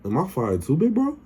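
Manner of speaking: imitated question leaping very high at the end; high pleading question. Spanky asking his boss about his job, comically high-pitched. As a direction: send the last words way up, comically.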